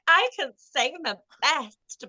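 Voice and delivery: slow, high boastful diva; sing-song tipsy boasting; drunk diva. Retold or imitated. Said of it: "I feel like I'm a [name]".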